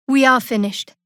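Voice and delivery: loud, firm statement; loud declaration. Acted. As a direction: slam the door on the deal. Ending a deal.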